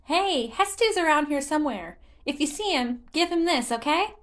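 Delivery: high, chirpy little sprite; a friendly kid handing over a gift; childish Korok. Acted. Korok giving Link a seed for Hestu, high chirpy voice.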